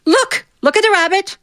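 high, sing-song teacher showing the class a rabbit. Retold or imitated. Fifth-grade teacher calling the class to watch the rabbit, high and sing-song. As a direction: pitch way up like a delighted teacher pointing at the rabbit. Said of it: "she'd go".

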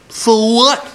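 put-on high, cheeky sneer of a rude girl; rude girl retort. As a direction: toss it back like a bratty kid.

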